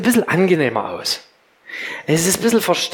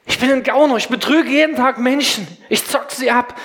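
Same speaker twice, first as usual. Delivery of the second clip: swindler's confession; 7 semitones higher than usual. Acted. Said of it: "Der Gauner weiß".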